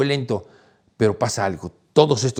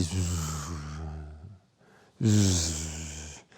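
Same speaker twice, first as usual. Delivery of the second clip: buzzing hum; 7 semitones lower, 8 dB quieter than usual. Retold or imitated. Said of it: "que era como que sentía así".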